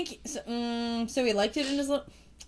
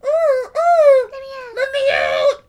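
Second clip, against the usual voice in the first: much higher, louder squealing shout; mock frog yell; 9 dB louder, much slower than usual. Friend mocks the trapped frog's cry, squealing high shout. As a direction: ham up a shrieking trapped frog. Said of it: "By yelling, you mean, like".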